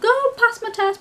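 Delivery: high, loud, gushing brag; mock an obligatory celebration post; excited announcement. Mimics an excited social media post.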